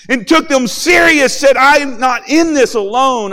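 loud, high, fervent preaching; full-force preaching. Acted. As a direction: preach it out to the whole congregation.

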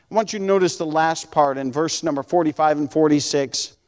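normal talking voice

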